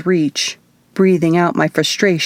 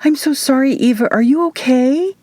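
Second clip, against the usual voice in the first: higher, fretful little witch voice; worried witch; 8 semitones higher than usual. A narrator's reading. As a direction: wring the words out in worry. Worried witch fussing over Eva.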